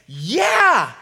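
impulsive, loud high shout; Peter. Retold or imitated. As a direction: jump at it without thinking, drawn-out and eager. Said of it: "how impulsive Peter is".

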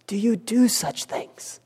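hushed, slow whispered question. Retold or imitated. Eli whispering to his wayward sons, hushed and slow. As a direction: breathe the question out like a grieved father.

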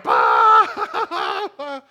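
loud mock cackle; mom's gotcha laugh. Mom laughing at kids biting onions, loud mock cackle. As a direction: burst out laughing like a pranking mom. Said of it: "the mom's like".

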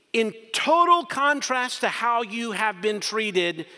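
slow, high and loud pulpit push; preacher at full force; forceful preaching. Acted. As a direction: drive the contrast home from the pulpit.

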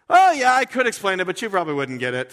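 loud, offhand reply; breezy God. Retold or imitated. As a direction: brush off the question lightly.

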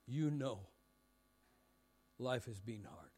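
low, soft, reverent sermon close; gentle pastoral appeal. Real, not acted. Preacher inviting the church to the Lord's table, hushed and reverent. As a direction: bring the room down to hushed reflection, with long pauses. Closing appeal before communion.

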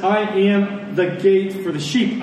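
ordinary talk, nothing marked

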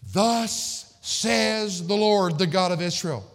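slow, loud, high declaration; forceful scripture proclamation. Acted. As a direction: proclaim it like God's herald, each word drawn out. Reads scripture at full force.

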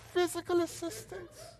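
very high, thin proper English voice; a stiff, overly polite plea; prim Englishman. Retold or imitated. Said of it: "so he was very proper".

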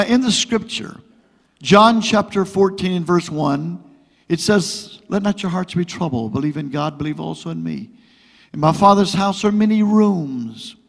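ordinary talk

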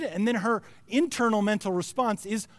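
normal talking voice

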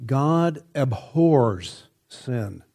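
very slow, every word weighted; "God abhors sin" drawn out slowly; slow emphatic. Acted. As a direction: lay it down word by word. Emphatic sermon point.

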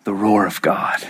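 low, whispery solemn refrain; hushed awe. Real, not acted. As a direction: breathe it out low in reverence. Hushed echo after Jesus's death.

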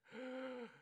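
a sharp high gasp for air, like someone just winded. Retold or imitated. Retelling the fallen student's reply, a winded gasp. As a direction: gasp as if the wind was knocked out of you. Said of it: "he looks up at me and goes".

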